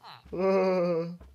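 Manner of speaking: loud sudden laugh; burst of laughter. Real, not acted. Player laughing out loud at what he found.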